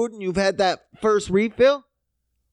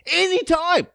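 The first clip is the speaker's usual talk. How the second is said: "Any time" jumps higher and louder; outraged higher repeat; 11 semitones higher, 3 dB louder than usual. Real, not acted. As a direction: throw the words up in disbelief.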